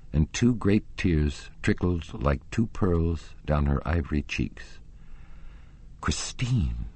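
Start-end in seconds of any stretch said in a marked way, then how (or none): 6.0-6.9 breathed out in a whisper. A narrator's reading. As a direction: whisper his love's name, moved by her tears. Tearful reunion, name breathed.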